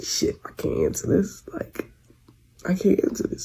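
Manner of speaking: hushed, breathy whisper so he won't hear; nervous secret whisper next to him; whispered panic. Retold or imitated. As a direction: whisper it under your breath in the car. Hiding a call from him.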